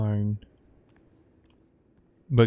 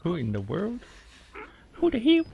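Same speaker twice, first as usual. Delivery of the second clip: startled outburst; 10 semitones higher than usual. Retold or imitated. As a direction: jump at the scare and squeal it. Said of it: "I'm like".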